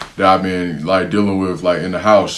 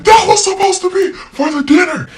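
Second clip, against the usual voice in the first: high shrill-pitched squeal of an angry mom, louder; mock yelling mom; 4 dB louder than usual. Nanny imitating the mom screaming about dinner chicken. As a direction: squeak it out like an outraged mother.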